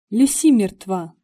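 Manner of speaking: breathless, blurted out loud; urgent panting report. Acted. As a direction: gasp it out, out of breath. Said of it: "задыхаясь, выпалила я".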